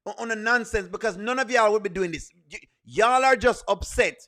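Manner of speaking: usual speaking voice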